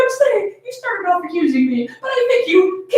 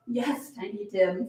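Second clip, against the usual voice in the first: lower, much softer and slower; fond reply; 10 semitones lower, 13 dB quieter, slower than usual. Acted. Mother answering her excited daughter, soft and slow. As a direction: tease the child gently.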